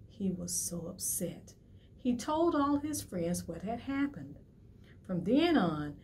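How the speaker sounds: usual speaking voice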